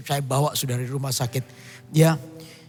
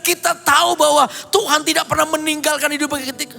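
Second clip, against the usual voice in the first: high-pitched, driving sermon voice; fervent preaching; 9 semitones higher, 4 dB louder than usual. Acted. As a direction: preach it to the congregation with fire. Preaching at full force.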